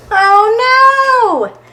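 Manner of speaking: high, loud oh no; dismayed exclaim. Acted. Reading to children, cries out at the mess, high and loud. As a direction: gasp it out for the kids.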